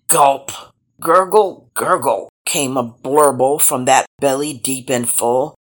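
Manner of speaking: plain reading voice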